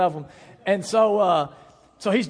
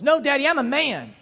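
put-on high, loud toddler refusal; mock defiant toddler yell; imitating toddler son; 10 semitones higher, 5 dB louder than usual. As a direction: stand up to Dad like a little boy. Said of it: "And he goes".

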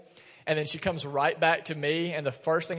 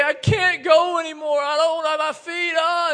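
put-on high, loud wailing cry of a worn-out child; exhausted daughter sobbing to her dad on the roadside, loud crying wail; 11 semitones higher, 7 dB louder than usual. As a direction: wail it like a little kid melting down in a yard. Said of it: "began to just cry and say".

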